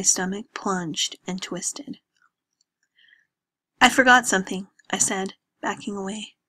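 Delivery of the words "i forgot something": louder, rushed cover; abrupt upset excuse; flustered excuse. Acted. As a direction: blurt it while backing away. Lena flees after heartbreak.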